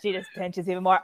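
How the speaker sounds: ordinary delivery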